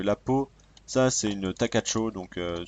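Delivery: ordinary talk, nothing marked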